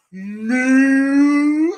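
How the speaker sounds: high, loud, long moo rising; mocking cow moo. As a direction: taunt a rival with a big moo. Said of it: "This is you".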